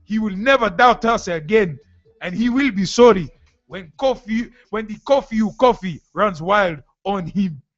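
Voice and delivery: wrestler's promo in a put-on Ghanaian accent; wrestling promo. Host imagining spiritualist cutting wrestling promo, hammed-up accent. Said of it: "you can imagine him cutting a promo".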